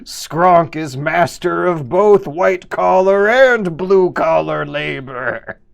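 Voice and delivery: loud, slow boasting brute; barbarian voice. Acted. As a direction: boom it like a proud barbarian. Boasts in Skronk's voice.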